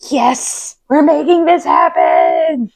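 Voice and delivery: slow triumphant shout; excited shout. Retold or imitated. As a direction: cheer it out, drawn out. Excited retold cheer.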